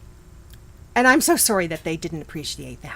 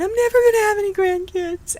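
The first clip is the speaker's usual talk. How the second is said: higher, quick lament as mom; quoting mom; 13 semitones higher, 4 dB louder, faster than usual. Retold or imitated. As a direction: mimic mom's mock despair, fast. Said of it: "My mom was saying at one point".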